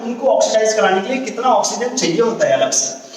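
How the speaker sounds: usual speaking voice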